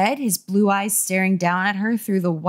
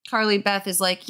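usual speaking voice

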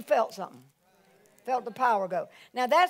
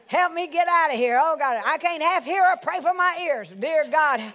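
mock exasperated prayer; 4 semitones higher, 7 dB louder than usual. Acts out an exasperated worshipper's prayer.

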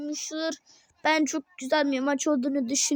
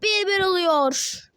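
lively match play-by-play, raised pitch; football commentator; 9 semitones higher, 7 dB louder, slower than usual. Acted.

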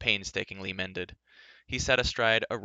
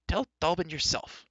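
higher-pitched defiant retort; called over shoulder; 8 semitones higher than usual. Acted. Busy youth answering a bossy prince, defiant call. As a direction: toss it back as you keep working. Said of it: "Taran called over his shoulder".

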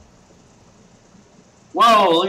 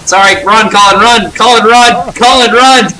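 urgent loud shout; yelling warning; 4 semitones higher, 9 dB louder than usual. Real, not acted. Teammate in danger, yelling.

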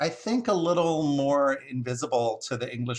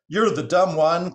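loud, higher crow retort; crying out; 6 semitones higher, 8 dB louder than usual. Acted. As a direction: cry it down at your rival. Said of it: "cried the crow from above".

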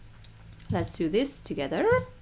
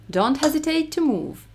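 normal talking voice

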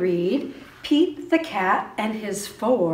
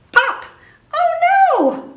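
much higher, louder playful alarm; mock surprise; 12 dB louder, much slower than usual. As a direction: gasp it out for the kids, big and high. Button pops off in story.

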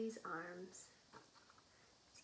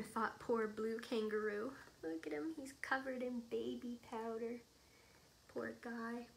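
plain reading voice

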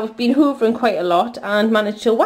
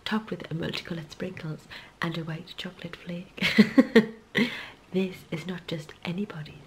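M&S advert; 4 semitones lower, 5 dB quieter than usual. Acted. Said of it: "That was my best M&S impression".

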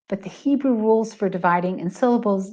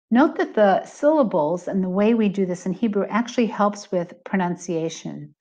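usual speaking voice

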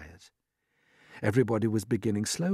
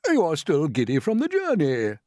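higher, louder, assured voice; old green grasshopper; 6 semitones higher, 5 dB louder than usual. A narrator's reading. Grasshopper dismissing the ladybird's worry, higher and assured. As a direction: brush her worry aside. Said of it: "the old green grasshopper told her".

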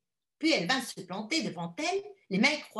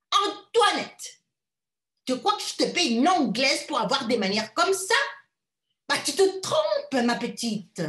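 high, loud scolding mother; shouting; 5 semitones higher, 7 dB louder than usual. A narrator's reading. Mother shouting at her daughter over manners. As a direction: tear into the girl. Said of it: "Madame cria".

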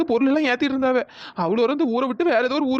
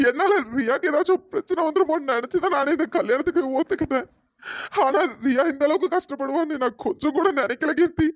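tearful regret; 2 semitones higher than usual. Acted. Brother laments hurting Riya, cry heard.

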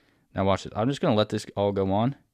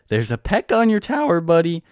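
voice cracking; 8 semitones higher, 4 dB louder than usual. Real, not acted. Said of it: "that was the worst voice crack ever".

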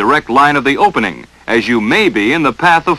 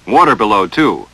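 ordinary delivery

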